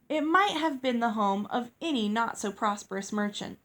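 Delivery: plain reading voice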